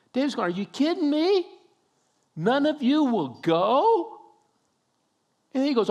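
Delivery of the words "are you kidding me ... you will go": high, loud, stunned questions; incredulous. Retold or imitated. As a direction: ask in disbelief, voice jumping up. David incredulous at the soldiers.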